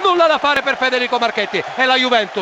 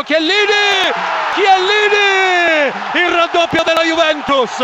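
stretched roaring goal call; goal shout; 8 semitones higher, 6 dB louder, slower than usual. Real, not acted. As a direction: yell the scorer's name.

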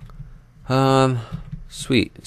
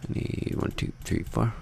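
much softer, breathy whispered counting; hushed count under the breath; 3 semitones higher, 10 dB quieter than usual. Shown as a demonstration. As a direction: whisper the numbers to yourself.

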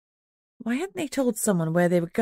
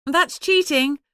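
high, louder indignant yell; yelled at dial tone; 8 semitones higher, 4 dB louder, slower than usual. A narrator's reading. Narrator yelling after Jess hangs up. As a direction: shout it at the dead line. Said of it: "I yelled at the dial tone".

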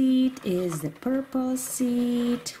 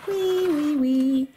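loud, slow wee; loud, slow wheeing; 3 semitones higher, 6 dB louder than usual. Acted. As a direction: cheer the ride. Carousel spinning.

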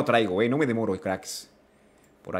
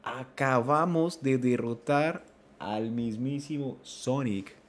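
slow, hushed disbelief; stunned awe; 4 semitones lower, 9 dB quieter, much slower than usual. Real, not acted. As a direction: say it softly, spacing out the words. Stunned after the fight. (asombro lento y en voz baja)

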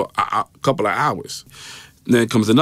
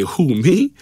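higher, rising mock-innocent question; incredulous comeback; 6 semitones higher, 3 dB louder than usual. Teen imagining talking back to the detective, incredulous. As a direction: voice jumps up in disbelief.

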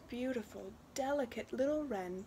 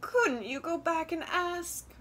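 higher, weary coaxing; weary mother; 8 semitones higher, 4 dB louder than usual. Acted. Mother nudges daughter to return to the wren, weary appeal. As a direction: wearily press the child with a raised pitch. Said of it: "her mother said wearily".

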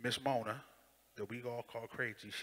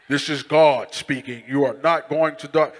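loud, slow booming God voice; booming wrong take; 19 dB louder than usual. Shown as a demonstration. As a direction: thunder it like a movie God. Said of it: "No, it wasn't".

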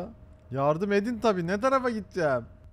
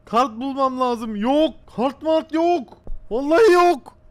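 high, loud frustrated shouting; exasperated yelling that climbs higher and louder; angry outburst; 6 semitones higher, 8 dB louder than usual. Real, not acted. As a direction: shout it, building to a peak. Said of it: "Kızınca tatlışko oluyorsun".

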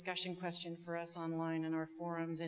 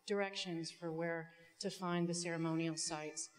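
normal talking voice

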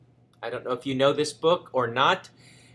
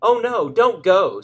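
louder, higher and slower, a worried hen's cry; alarmed cry; 6 semitones higher, 8 dB louder, slower than usual. Acted. Chicken Little warning Henny Penny, mock alarmed cry. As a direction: plead with her not to go. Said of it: "said Chicken Little".

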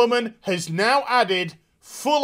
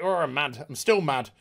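mock Arthur; 5 semitones lower, 5 dB quieter than usual. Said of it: "he's just like".

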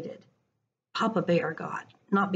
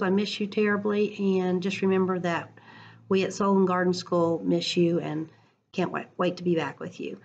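usual speaking voice

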